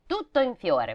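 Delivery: plain reading voice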